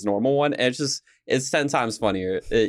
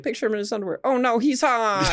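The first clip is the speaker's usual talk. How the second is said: mock cartoon lines; 9 semitones higher than usual. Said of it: "he was like".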